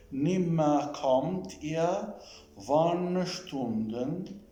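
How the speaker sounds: slow, carefully spaced German text reading; measured model reading with pauses between phrases; slow dictation. Shown as a demonstration. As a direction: read it out slowly, word by word, with long vowels. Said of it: "say it slowly".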